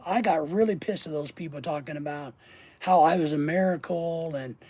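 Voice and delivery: ordinary talk, nothing marked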